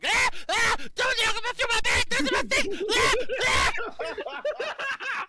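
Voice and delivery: high, very loud frantic screams; screaming. Acted. Prisoner screaming at the party searching his bag. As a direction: scream in panic to guard the bag. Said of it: "he's yelling and screaming".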